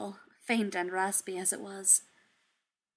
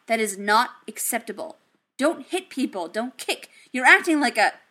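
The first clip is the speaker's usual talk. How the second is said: loud firm telling-off; angry parent scolding; 8 dB louder than usual. Acted. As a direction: lay down the law, loud. Tony scolding Dummy.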